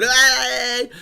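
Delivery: high mock yell out of a car window; kids yelling out. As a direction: hoot it like a teen hanging out the window.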